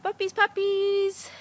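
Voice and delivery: high loud sing-song call. Real, not acted. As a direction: call the puppies in a bright coo. Calling puppies.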